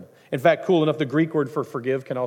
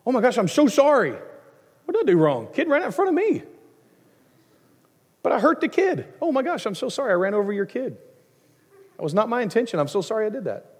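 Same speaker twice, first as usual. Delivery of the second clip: higher, rushed, mock-frantic sorry to a mother; flustered apology; 7 semitones higher than usual. Runner apologizing to a mom after knocking her kid over, flustered and higher. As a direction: act out blurting apologies, hands up, pitched up. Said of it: "what am I gonna say to the mom?".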